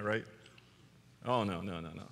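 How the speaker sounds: ordinary talk, nothing marked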